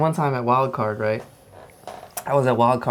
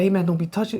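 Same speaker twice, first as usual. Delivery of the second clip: coach confronting; 7 semitones higher, faster than usual. Retold or imitated. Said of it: "he's like".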